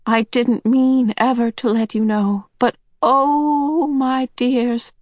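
put-on rising, tender old woman; a hint of an old woman pouring out her heart; lightly voiced Missus Whatsit, pleading. A narrator's reading. Missus Whatsit to the children, rising tenderly.

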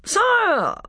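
very high, piping mouse cry; shrill voice. A narrator's reading. Mouse calling for the king's attention, shrill. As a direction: pipe up sharply from far below. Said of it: "came a shrill voice from near the ground".

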